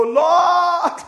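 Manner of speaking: loud, high, drawn-out shout to God; crying out. Shown as a demonstration. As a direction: cry out at full volume, pitch soaring. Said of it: "Fall on your face and go".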